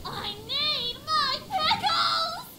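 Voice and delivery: slow, high, hammed-up cry for pickles; desperate shout. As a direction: wail it like a craving. Pickle-obsessed islander's desperate outburst.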